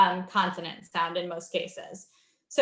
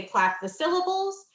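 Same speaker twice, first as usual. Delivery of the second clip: teacher's cue; 10 semitones higher than usual. Shown as a demonstration. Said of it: "a teacher may say".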